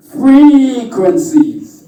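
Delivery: FREQUENCIES high, loud and long drawn out; emphatic punchline. Shown as a demonstration. Speaker swaps company for the F word, drawn-out emphatic cry.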